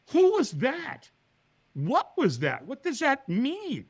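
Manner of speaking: high, drawn-out bewildered questions; baffled. Retold or imitated. Man left wondering after stranger vanishes, baffled. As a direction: puzzle aloud in mock astonishment.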